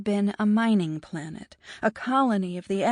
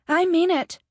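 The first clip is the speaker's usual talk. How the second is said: loud and much higher; insistent; 10 semitones higher, 7 dB louder than usual. A narrator's reading. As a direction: insist it loudly. Bianca insists.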